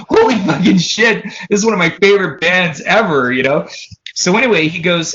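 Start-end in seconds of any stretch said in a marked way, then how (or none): 0.1-3.3 higher-pitched retold amazement; thrilled disbelief, acted out; starstruck excitement. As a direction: gush like an awestruck fan, half laughing. Reacting to news of favorite band.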